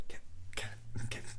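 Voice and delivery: urgent whispered call of a name. Retold or imitated. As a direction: hiss the name under your breath. Whispering to friend in band.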